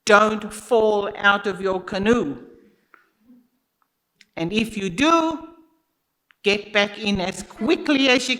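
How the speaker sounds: imitating a guide's loud African accent; imitated African accent. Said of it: "with his thick African accent".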